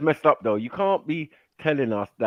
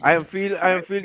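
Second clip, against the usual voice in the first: higher, mock whimper of a man not in the mood; whiny reluctant partner; 6 semitones higher than usual. Partner groaning that he isn't in the mood, a mild mock whine. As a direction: moan like you're too tired for it.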